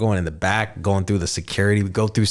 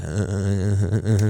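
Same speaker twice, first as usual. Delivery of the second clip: starstruck stammer. Retold or imitated. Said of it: "I was kinda like".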